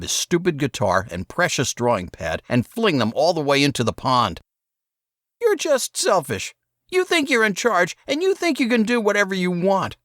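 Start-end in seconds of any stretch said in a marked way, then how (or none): 5.4-10.0 put-on high, rushed kid voice; boy Saul, accusing. A narrator's reading. Saul rants at his brother, high and fast.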